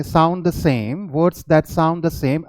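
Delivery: slow, deliberate teacher reading; slow dictation-style read; slow, careful reading. Shown as a demonstration. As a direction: spell it out slowly for the class. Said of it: "slow English definition read".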